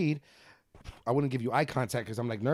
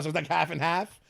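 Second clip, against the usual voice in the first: ordinary talk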